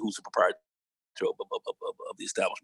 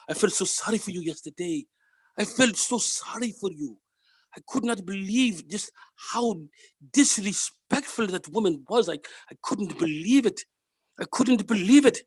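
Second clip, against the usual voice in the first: imitated Indian accent; 7 semitones higher than usual. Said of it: "I'll try to get his accent down".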